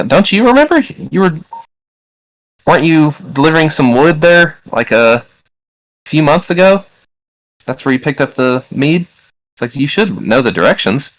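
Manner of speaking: high woman's voice, questioning; puzzled woman. Acted. Woman reminding her supposed husband, high questioning voice. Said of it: "a voice from a woman say".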